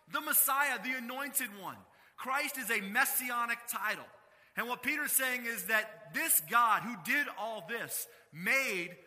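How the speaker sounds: loud, emphatic pulpit delivery; preaching. Acted. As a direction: drive the point home to the congregation.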